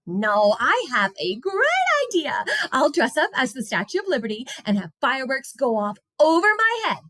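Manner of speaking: put-on high, bright-eyed child voice; girl excited about her idea. A narrator's reading. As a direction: burst out with a big plan. Molly character voice.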